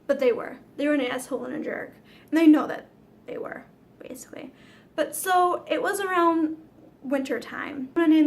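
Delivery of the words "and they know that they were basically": a quick breathy whisper under her breath; whispered aside. Real, not acted. To viewers about the siblings, whispered aside. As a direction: let it slip out as a secret aside.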